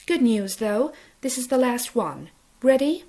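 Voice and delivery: put-on cheery Capitol accent, up at the end; bright sing-song Capitol accent, rising on ready; chirpy Capitol accent. A narrator's reading. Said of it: "her silly capital accent".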